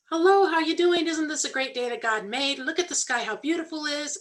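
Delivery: high, bright imitation of a happy-go-lucky friend; bubbly and upbeat, mock-cheerful greeting; cheery churchgoer. Mimicking John greeting her with over-the-top cheer. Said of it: "he would be just so happy-go-lucky".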